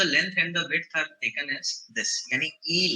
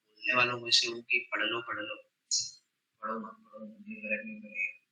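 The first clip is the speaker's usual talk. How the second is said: disappointed and quiet; 6 semitones lower, 8 dB quieter than usual. Real, not acted. Disappointed teacher talks softly.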